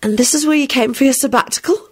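high, wry questioning; amused, high-pitched disbelief. A narrator's reading. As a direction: ask it with a wry smile. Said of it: "said to Lupin with a wry smile".

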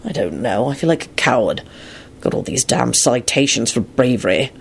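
low, soft self-disgust; bitter. A narrator's reading. Lupin calling himself a coward, bitter. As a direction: admit it bitterly under your voice. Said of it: "rather bitterly".

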